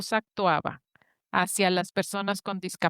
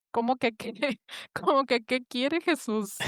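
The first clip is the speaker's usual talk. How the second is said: laughing disbelief; 4 semitones higher than usual. Retold or imitated. Her own baffled reaction retold.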